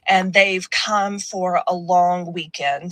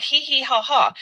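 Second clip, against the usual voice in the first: spelled-out mock giggle, pitched up; fake polite laugh; 5 semitones higher than usual. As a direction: imitate a forced, polite titter. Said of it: "we're just kinda like".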